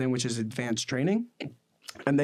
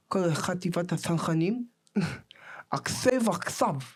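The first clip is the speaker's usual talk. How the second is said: high, fast lisping officer voice; lisping commander; 5 semitones higher than usual. Retold or imitated. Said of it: "he has a lisp".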